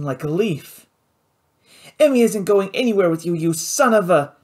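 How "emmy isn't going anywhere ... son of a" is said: angry defiance. Acted. Pilot defiant shout.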